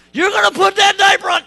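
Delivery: loud and high, demanding; exasperated parent shout. Retold or imitated. Dad yelling at toddler about the diaper, loud demand. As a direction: bark it at the toddler.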